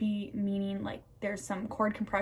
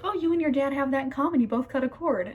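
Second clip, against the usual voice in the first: imitating mom; 5 semitones higher, 5 dB louder, faster than usual. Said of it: "My mom's like".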